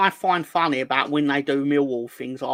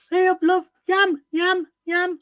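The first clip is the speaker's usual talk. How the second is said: put-on much higher, louder caricature of a Birmingham accent; squeaky over-the-top Brummie farmer voice; mock Brummie; 5 dB louder, slower than usual. Mocking Midlands accents to his co-host, squeaky Brummie caricature. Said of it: "Oh, from Birmingham".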